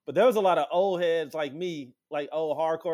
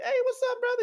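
much higher, bright hey to the guitarist; eager greeting; faster than usual. Retold or imitated. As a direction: pop the pitch up on hey.

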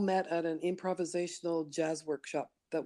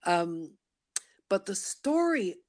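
ordinary talk, nothing marked

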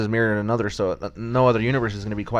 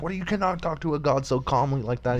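higher-pitched mock panic; freaking out; 6 semitones higher than usual. Retelling Beerus scolding Goku for casual talk, mock freaking out. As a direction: act mildly aghast, pitch raised. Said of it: "Beerus is freaking".

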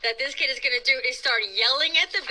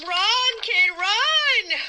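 urgent cheer; 9 semitones higher, 6 dB louder, much slower than usual. Real, not acted. Host urging boy to flee.

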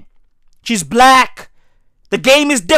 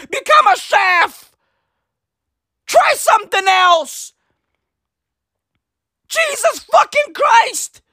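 angry rant; 6 semitones higher than usual. Real, not acted. Ranting at gymnasts to do anything else, fed-up yelling. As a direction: blow up in frustration.

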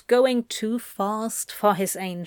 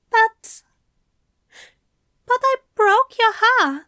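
high, stammering heartbroken voice; devastated and high; 12 semitones higher, 7 dB louder than usual. A narrator's reading. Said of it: "absolute devastation with which Aziraphale spoke".

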